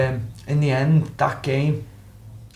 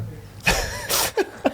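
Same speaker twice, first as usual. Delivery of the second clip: a high, airy burst of laughter; high breathy laugh; 4 dB quieter than usual. Real, not acted. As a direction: laugh at your own story. Laughs after smashing changing rooms.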